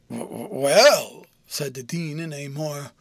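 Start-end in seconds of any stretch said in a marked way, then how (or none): 0.1-1.3 stammered, drawn-out well with swooping pitch; hemming and hawing; hesitant. A narrator's reading. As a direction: stall on the word, dragging it out slowly. Dean hesitates before qualifying his agreement.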